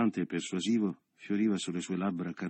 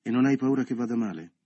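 pressing; 6 semitones higher, 8 dB louder than usual. A narrator's reading. Carla pressing Leo.

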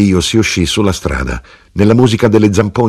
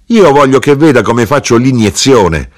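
higher-pitched, commanding mother; forceful insistence; 6 semitones higher, 4 dB louder than usual. A narrator's reading. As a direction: insist on having your way. Mother imposes her will on widow.

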